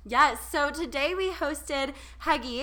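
ordinary talk

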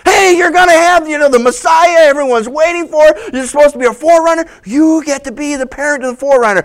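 high, loud eager announcement; excited angel. Acted. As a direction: rush the good news.

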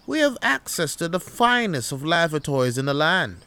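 hammed-up English accent, breezily dismissive; pompous English camper accent; put-on English accent. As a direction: brush off complaints in grand English tones. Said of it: "what the fuck is up with this English accent?".

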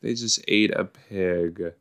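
ordinary talk

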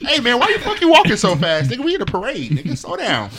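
high, loud, laughing mock rant; laughing mock complaint. Mocking the fast-walking alternate friend, laughing loud. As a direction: ham up annoyance at a fast walker.

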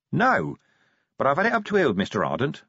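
mildly growled, rapid complaint; growled irritation. Acted. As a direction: hurry it out, fed up. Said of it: "Vimes growled".

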